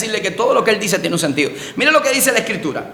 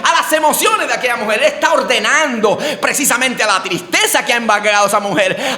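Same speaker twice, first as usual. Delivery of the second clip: normal talking voice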